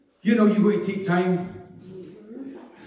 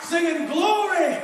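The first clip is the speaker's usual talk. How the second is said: high, driving revival preaching; 10 semitones higher, slower than usual. Real, not acted. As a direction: preach it like you feel it. Preaches at full force.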